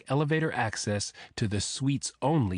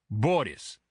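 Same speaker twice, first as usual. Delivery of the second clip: put-on name in a thick Russian accent. A narrator's reading. Bodyguard giving his name to the agent, thick Russian accent. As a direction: give the name with a tapped Russian R. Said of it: "in the same thick accent".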